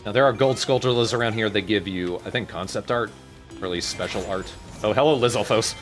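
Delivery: normal talking voice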